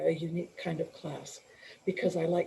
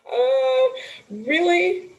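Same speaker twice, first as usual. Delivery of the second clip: high, loud disbelieving surprise; surprised; 12 semitones higher, 9 dB louder, much slower than usual. Retold or imitated. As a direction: react as if you can't believe it. Reacting to "Perfect" feedback.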